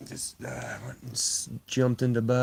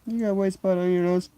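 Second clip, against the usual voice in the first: higher, louder cooing at the cat; pet-talk voice; 7 semitones higher, 5 dB louder than usual. Real, not acted. Streamer admiring cat's nose, high cooing.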